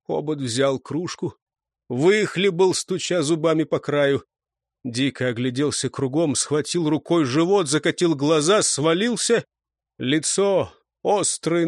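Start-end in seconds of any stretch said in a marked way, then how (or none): none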